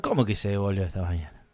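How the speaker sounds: low, quick, deadpan question; stunned disbelief. Retold or imitated. Customer answers clerk about returned package, quietly stunned. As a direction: drop the voice, softly incredulous.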